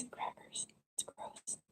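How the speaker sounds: soft unvoiced whisper. Real, not acted. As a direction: whisper under the breath. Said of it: "my quiet voice".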